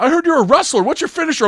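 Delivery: very high, loud excited ask; eager new kid. Retold or imitated. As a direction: gush like a starstruck freshman. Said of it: "he's like".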